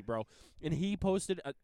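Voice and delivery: normal talking voice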